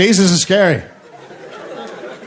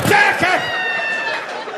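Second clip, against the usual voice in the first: high-pitched explosive sneeze; imitated sneezes; 3 dB quieter than usual. Driver sneezing six times in a row, high explosive sneezes. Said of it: "six times in a row".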